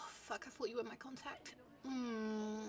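ordinary talk, nothing marked

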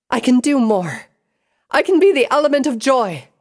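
high, loud insistence; defiant shout. A narrator's reading. Pinkie insisting to Sable she is able, defiant shout. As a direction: belt it out to prove yourself.

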